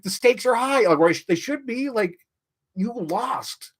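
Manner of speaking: usual speaking voice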